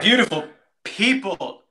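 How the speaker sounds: high, loud, sung-out host greeting; big show opener. Acted. Host welcoming listeners to the episode, big announcer greeting. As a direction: belt the welcome like a showman.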